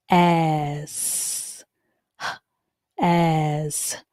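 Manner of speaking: slow sound-by-sound H, as; teacher segmenting the word has. Shown as a demonstration. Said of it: "The A has the A sound in this word".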